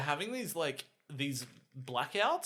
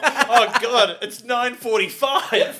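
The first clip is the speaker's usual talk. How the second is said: mock panic; 7 semitones higher, 13 dB louder, much slower than usual. Tom panicking after shower, mock.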